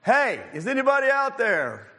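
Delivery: high-pitched call out of a hole; mock scream for help. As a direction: call up for anyone, acted, without full volume. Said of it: "he was screaming for people to come help him".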